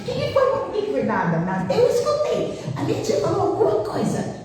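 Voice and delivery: put-on high, eager girl's voice pressing questions; nosy young daughter. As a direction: imitate the daughter demanding who it was. Filha curiosa imitada, voz aguda. (imitando a filha curiosa com voz aguda)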